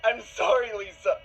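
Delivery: high sobbing plea, surging loud; anguished begging. Acted. Boyfriend begging his girlfriend to come back, anguished and tearful. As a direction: break down into repeated desperate apologies.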